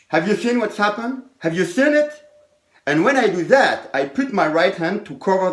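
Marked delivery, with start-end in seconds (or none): 0.1-2.1 high, bright showman question to the audience; showman patter. Shown as a demonstration. Magician quizzing the spectators, high excited patter. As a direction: ham it up like a stage magician. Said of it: "and I say".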